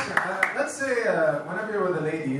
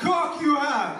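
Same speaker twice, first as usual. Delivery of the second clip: broken English; 6 semitones higher than usual. Acted. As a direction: make "Coke" come out as "cock", insistently. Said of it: "shitty English, right? So he can't say Coke".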